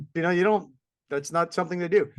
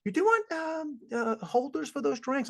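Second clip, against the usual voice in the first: imitating cheerful clerk; 10 semitones higher, 4 dB quieter than usual. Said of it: "And goes".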